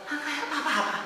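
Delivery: a high laugh breaking through; father laughs at his son's drawing; laughing disbelief. Retold or imitated. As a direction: laugh it off in sudden surprise.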